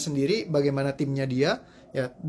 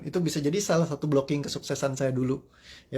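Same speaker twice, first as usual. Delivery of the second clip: ordinary delivery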